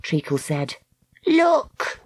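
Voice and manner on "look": put-on girl voice. Girl character voiced in story.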